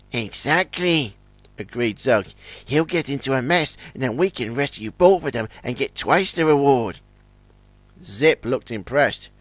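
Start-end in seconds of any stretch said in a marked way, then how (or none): 0.1-7.0 soft, sly plotting voice; scheming quietly. Acted. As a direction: murmur the crafty plan. Scheming whisper to Zip.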